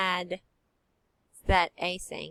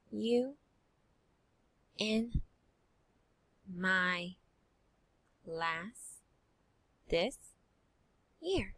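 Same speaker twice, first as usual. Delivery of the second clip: word by word; much slower than usual. Shown as a demonstration. Teacher reading slowly word by word for the class. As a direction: read one word at a time with pauses. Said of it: "Now let's keep reading".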